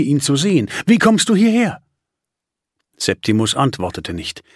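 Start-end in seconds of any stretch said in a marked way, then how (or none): 0.9-1.8 high, bright with relief; overjoyed sister, amazed to see him. Acted. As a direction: gush the question out in happy surprise. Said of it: "so froh wie noch nie".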